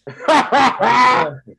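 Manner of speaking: loud high burst of laughter; big laugh. Real, not acted. Laughing at the thousand-dollar-bill story, loud burst of laughter. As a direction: crack up loudly at the joke.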